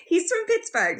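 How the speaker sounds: high, amused protest through giggles; laughing. Real, not acted. Explaining his hometown to viewers, laughing. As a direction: say it while still laughing.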